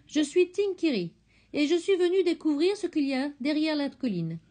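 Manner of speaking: voix d'enfant fière, nettement plus aiguë; présente-toi comme une fillette; petite fille. A narrator's reading. (la fillette se présente à l'oiseau, voix aiguë)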